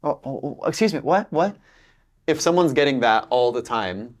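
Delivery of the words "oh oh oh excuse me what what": higher, puzzled what did you say; confused listener. Retold or imitated. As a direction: play a lost American who cannot follow. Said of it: "they just say".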